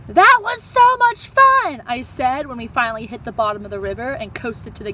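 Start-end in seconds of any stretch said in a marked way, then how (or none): 0.1-1.8 high, loud, slow gush; thrilled. Acted. Kid gushing to friends after the waterfall ride. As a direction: still buzzing from the ride.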